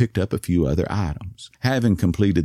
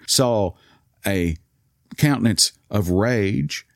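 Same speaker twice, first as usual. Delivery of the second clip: plain reading voice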